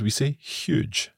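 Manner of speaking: "huge" with a sounded h, as in Scotland; Scottish huge with H. Shown as a demonstration. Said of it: "or in Scotland".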